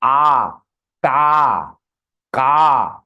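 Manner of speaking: A, Ta, Ka each held on an open "aah"; long A demonstration. Shown as a demonstration. Teacher has students hear A in Ka, long open "aah". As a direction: draw out the vowel in A, Ta, Ka. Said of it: "So you are hearing A".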